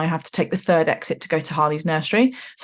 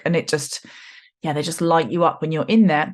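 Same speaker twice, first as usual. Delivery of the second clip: ordinary delivery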